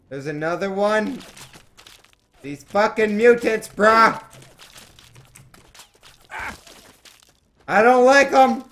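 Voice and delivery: high, very loud raging shout. Real, not acted. Gamer raging at mutants attacking him, loud furious shout. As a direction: scream your fury at the mutants.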